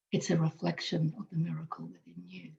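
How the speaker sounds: soft whispered reveal; whispered, intimate. Real, not acted. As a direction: hush it as a tender secret. Tender insight about listener.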